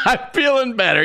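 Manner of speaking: high, loud, laughing through the words; cracking up while imitating her; laughing mock quote. To his host, imitating the woman through loud laughter.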